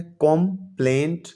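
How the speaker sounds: Complaint said slowly, split into its two syllables; teacher stretches complaint out to show its syllables; syllables spelled out. Shown as a demonstration. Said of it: "complaint भी टू syllable word है". (Complaint को धीरे धीरे दो हिस्सों में बोलें)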